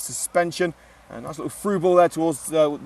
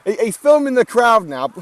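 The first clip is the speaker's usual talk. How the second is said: higher and louder, laughing disbelief; mock outrage at the cameraman; amused exclamation; 7 semitones higher, 9 dB louder than usual. Real, not acted. Commentator laughing at the cameraman filming the crowd, mock disbelief.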